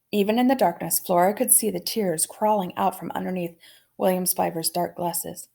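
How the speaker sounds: ordinary delivery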